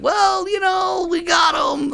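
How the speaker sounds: high, slow, mock-innocent mom voice; imitating mom. Son imitating his mother explaining the kittens, high and slow. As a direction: play the mother as innocently casual. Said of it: "Mom's like".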